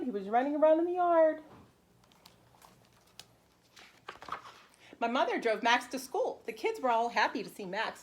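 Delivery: bright, lively read-aloud for preschoolers; animated picture-book narration; storybook reading. Acted. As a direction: read it like story time for little kids. Teacher reads picture book aloud.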